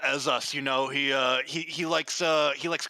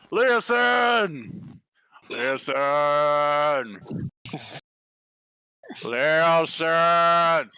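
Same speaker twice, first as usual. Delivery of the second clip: loud call across the room; calling a friend from the other room; 4 dB louder, much slower than usual. Acted. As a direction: shout a name to someone far off.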